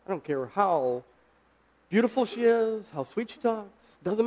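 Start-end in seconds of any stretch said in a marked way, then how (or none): none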